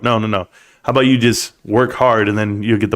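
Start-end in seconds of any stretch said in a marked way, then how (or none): none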